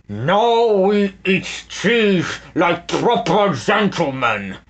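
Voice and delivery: slow pompous villain command. A narrator's reading. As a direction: command it grandly. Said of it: "Snatcher commanded".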